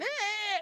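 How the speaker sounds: put-on high, held toddler screech; long high-pitched tantrum squeal; mock kid's scream. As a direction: imitate a screaming five-year-old. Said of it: "five-year-old kid walking and screaming in the floor".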